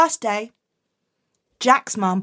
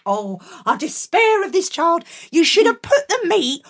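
ordinary delivery

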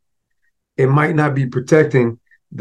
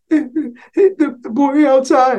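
put-on much higher whiny child voice; little boy; 13 semitones higher, much slower than usual. Boy tells mother the boy outside hit him, high whine. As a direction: answer like a teary five-year-old.

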